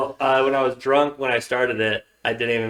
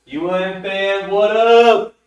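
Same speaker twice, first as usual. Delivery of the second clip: hype greeting; 9 semitones higher, 6 dB louder, slower than usual. Acted. Calling out fan greeting.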